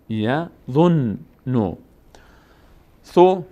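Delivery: یظنو stretched, the doubled n held; very slow demo. Shown as a demonstration. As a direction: draw یظنو out slowly. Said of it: "یک بارم با ضمهٔ خودش". («یظنو» بسیار کشیده، نون مشدد بلند)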